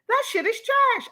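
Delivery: indignant, disgusted; angry outburst. Real, not acted. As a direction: spit out "trash" high. Angry about daughter attacked.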